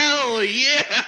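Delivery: loud, high whoop; excited cheer. Real, not acted. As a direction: celebrate the nat twenty. Natural twenty celebration.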